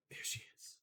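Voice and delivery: whispered to not scare the cat. Real, not acted. Streamer sees her cat, whispered. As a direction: hush it like spotting a cat.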